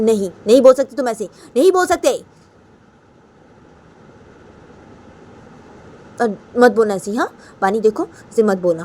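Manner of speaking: loud, forceful forbidding; acted angry scolding. Raima's abbu forbidding Bani, loud acted scolding.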